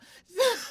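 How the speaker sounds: a sudden squealing laugh up high; high burst of laughter. Real, not acted. Mother laughing at how her son's questions freaked her out, high loud burst of laughter.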